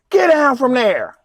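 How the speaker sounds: loud, slow barked order; mock cop yell. Cop yelling at trucker on his truck, loud order. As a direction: bark it like a cop ordering him down.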